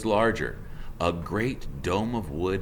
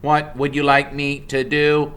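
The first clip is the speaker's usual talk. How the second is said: level, even machine voice, higher and louder; robot voice; 7 semitones higher, 8 dB louder than usual. Acted. The robot asks Mister Beaver for orders in a level machine voice. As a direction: ask like an eager helpful machine.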